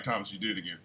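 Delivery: low, quiet imitation of a wife; softly needling under the breath; wife's hushed aside. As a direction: murmur it low, like a puzzled wife nudging her husband.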